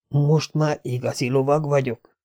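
a hushed dazed question; quiet musing of a battered knight; inner question. Acted. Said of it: "jutott eszébe a kérdés".

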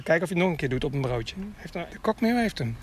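usual speaking voice

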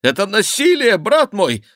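high, loud indignant cry; outraged, panicky king; alarmed protest. A narrator's reading. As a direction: protest with voice leaping up. King protests being held.

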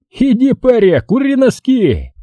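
very high, drawn-out cry; battle exclamation. A narrator's reading. Brawler's catchphrase while ramming an opponent, exclaiming. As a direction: call out the catchphrase high and slow. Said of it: "при этом воскликнет".

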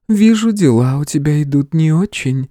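high, gentle goddess; airy feminine tone, unruffled; tender female voice. A narrator's reading. Goddess observes hero's troubles, tenderly. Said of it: "ласковый женский голос".

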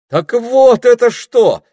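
loud, high, drawn-out exclamation; exclaiming. A narrator's reading. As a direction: cry out in sudden realisation. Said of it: "воскликнул Питер".

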